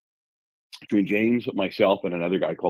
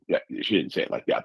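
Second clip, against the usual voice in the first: ordinary talk, nothing marked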